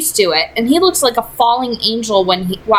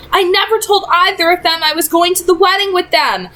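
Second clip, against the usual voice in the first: put-on higher, rushed panic of a cornered boy; a hint of panic, voice up high; panicked; 8 semitones higher than usual. A narrator's reading. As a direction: blurt it out in alarm. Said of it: "Simon sounded panicked".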